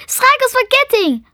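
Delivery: high-pitched urgent cry; shriek. A narrator's reading. Falling girl cries out a magic command, shrieking. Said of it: "Ze gilde".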